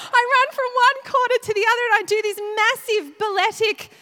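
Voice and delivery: high, loud, rushed storytelling; getting excited. Real, not acted. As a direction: get carried away telling it. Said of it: "I'm getting a bit excited".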